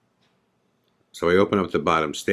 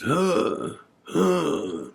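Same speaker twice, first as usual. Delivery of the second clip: high strained heaving gasps; dry heaving; 10 semitones higher than usual. Retold or imitated. Joey at the sink, imitated dry heaving. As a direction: retch out two high dry heaves. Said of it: "I could hear him dry heaving".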